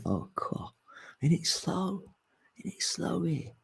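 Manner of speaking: high, soft whiny complaint; breathy high moan about slowness; mock dismay. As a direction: whine it like a tired newcomer. Voicing arrivals in linear time.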